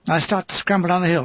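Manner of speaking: plain reading voice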